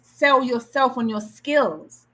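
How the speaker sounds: ordinary delivery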